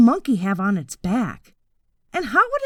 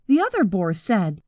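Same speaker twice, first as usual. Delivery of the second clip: plain reading voice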